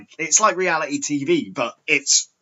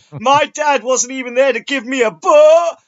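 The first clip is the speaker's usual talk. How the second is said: much higher, louder whiny caller; imitating Lorne; 13 semitones higher, 7 dB louder than usual. Retelling Lorne's call, high whiny mock voice. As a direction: whine it like a hurt man-child. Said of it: "then he goes".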